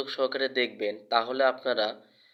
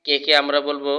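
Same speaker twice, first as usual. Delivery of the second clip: normal talking voice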